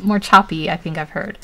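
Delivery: usual speaking voice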